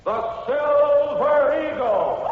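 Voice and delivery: slow, high drawn-out shout; booming title call. Acted. Announcer calling out the radio show's title. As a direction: call the show's name across the airwaves.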